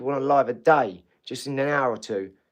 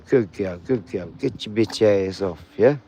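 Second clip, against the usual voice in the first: ordinary talk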